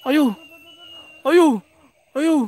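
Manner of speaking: ordinary talk, nothing marked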